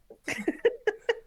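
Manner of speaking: high-pitched, real laughter. Real, not acted. Laughs at own story.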